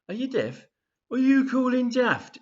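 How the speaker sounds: acted heated street row; exasperated snapping. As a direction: lose patience with a deaf listener. Exasperated exchange over mishearing.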